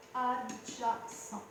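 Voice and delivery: "Adjacent" very slow, in separate syllables. Shown as a demonstration. Teacher writing the side's name, slow syllable by syllable. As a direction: stretch out "Adjacent" as if dictating. Repeats term while writing it.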